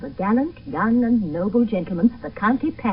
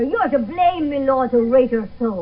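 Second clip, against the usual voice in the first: raised voice; 4 semitones higher, 5 dB louder than usual. Acted. Mother protests to husband.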